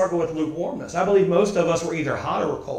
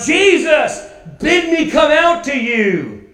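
higher, louder call across the storm, slowed down; 7 semitones higher, 4 dB louder, slower than usual. Acted. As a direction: call out to Jesus over the wind. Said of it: "Peter calls out".

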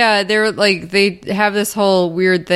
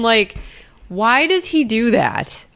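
ordinary delivery

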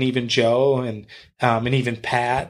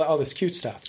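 normal talking voice

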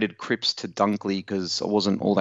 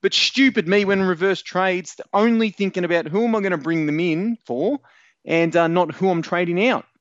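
exasperated self-reproach; 9 semitones higher than usual. Real, not acted. Fantasy player blames his reverse trades to co-host, exasperated. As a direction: kick yourself aloud, voice pitched up. Ranting about his own blunder.

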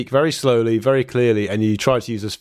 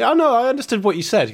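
much higher, mock-patronising sing-song; condescending; 10 semitones higher than usual. Mocking Swedes who patronise his Swedish, condescending. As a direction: imitate a smug local reassuring you. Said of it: "the same condescending thing".

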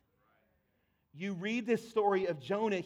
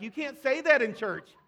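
high, loud, laughing mock outrage; mock shocked churchgoer; 6 semitones higher, 8 dB louder than usual. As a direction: play the scandalized listener. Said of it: "you're like".

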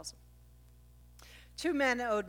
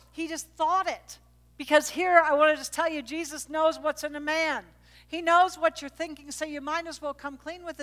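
higher, louder preaching; fervent preaching; 7 semitones higher, 6 dB louder than usual. Real, not acted. Preacher pressing the congregation, raised and fervent. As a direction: drive the point home to the church.

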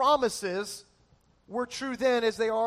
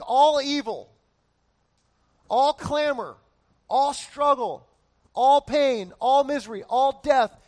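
building preacher emphasis; 3 semitones higher, 6 dB louder, slower than usual. Real, not acted. Preacher listing all evils.

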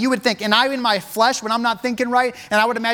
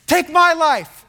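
high, raised-voice pleading; acted desperate appeal, pitched up; impassioned plea; 5 semitones higher than usual. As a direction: plead hard, offering yourself in someone's place. Judah pleads for his brother.

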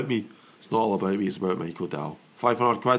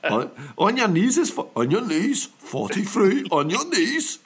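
higher, slow bingo call; drawn-out call to the hall; bingo caller; 8 semitones higher, slower than usual. Shown as a demonstration. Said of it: "echoing the bingo call".

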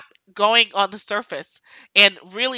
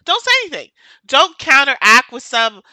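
higher, sharp order; angry command; 5 semitones higher, 9 dB louder than usual. Real, not acted. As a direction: snap the order at them. Ranting at critics.

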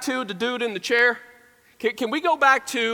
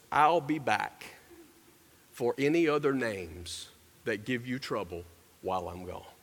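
soft, low warning, lightly acted; stern assistant principal; 8 semitones lower, 8 dB quieter than usual. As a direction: warn quietly, sinking away at the end. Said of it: "Don't mess with the assistant principal".

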